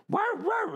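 high mock barking; high, irritating mock bark; imitated dog bark. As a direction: yap like the dog next door.

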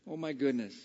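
normal talking voice

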